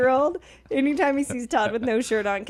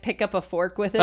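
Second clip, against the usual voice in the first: usual speaking voice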